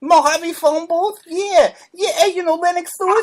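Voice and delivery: high, excitable put-on boxer voice; high-voiced, eager questioning; Mike Tyson. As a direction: pitch it up like the boxer on a prank call. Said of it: "this is Mike Tyson".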